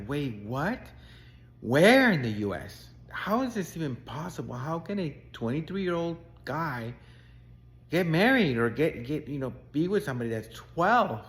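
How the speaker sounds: a stunned listener who can't believe it; shocked disbelief. Retold or imitated. Coach shocked by client's story.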